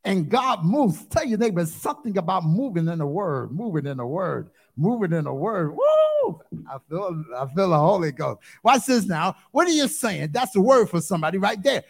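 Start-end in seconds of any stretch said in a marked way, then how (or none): 5.8-6.4 high falsetto woo shout; joyful woo. Real, not acted. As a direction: let out a sky-high woo of praise. Preacher shouts in worship.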